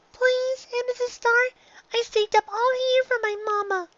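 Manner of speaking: a little filly begging for her mama's gift; pleading filly. A narrator's reading. Filly Dinky pleads again.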